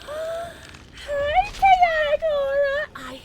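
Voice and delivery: very high, loud, drawn-out greeting; gushing squeal. Real, not acted. Streamer greeting a beloved viewer, gushing squeal. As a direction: squeal it with overflowing affection.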